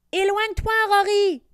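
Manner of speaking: high, loud warning cry; shout. Acted. Diego warning Rory away from the hydra, loud shout. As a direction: yell a warning across the sky. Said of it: "crie Diego".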